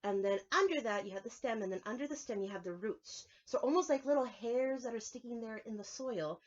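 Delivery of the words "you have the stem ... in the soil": usual speaking voice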